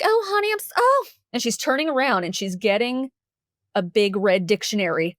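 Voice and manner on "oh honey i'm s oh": very high, pitying coo; sympathetic counselor. Retold or imitated. Counselor listening to upset student, high sympathetic cooing. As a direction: imitate a kind woman's heartfelt sympathy.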